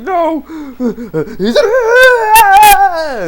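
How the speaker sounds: hammed-up shriek for a fallen friend, stretched long and high; unmanly scream. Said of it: "his scream's kinda unmanly".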